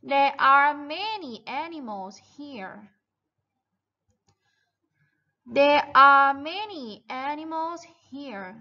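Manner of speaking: very slow, stretched-out sentence; model reading for repetition. Shown as a demonstration. Teacher reads the farm text for children to repeat, slow model reading. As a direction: read it out slowly, word by word. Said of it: "เราอ่านกันต่อค่ะ".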